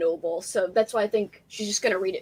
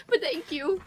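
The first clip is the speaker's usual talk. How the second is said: ordinary talk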